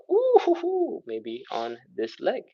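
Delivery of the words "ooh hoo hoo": high, mock-pained ooh hoo hoo; mock strain. Student feeling the stiffer leg, mock-pained high ooh hoo hoo. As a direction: play a student feeling the tight hamstring. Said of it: "and you're going".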